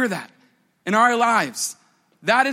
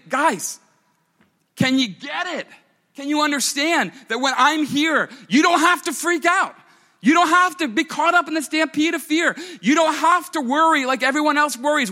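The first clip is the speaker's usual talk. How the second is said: higher-pitched, insistent acted appeal; acted exasperated urging, pitched up; impassioned exhortation; 6 semitones higher than usual. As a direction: plead with them to finally get it. Acted Jesus exhorting his disciples.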